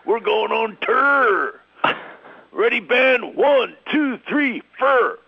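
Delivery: loud, high rock star yell; rock star shout. Retold or imitated. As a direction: hype the band like a rock star. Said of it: "He's like".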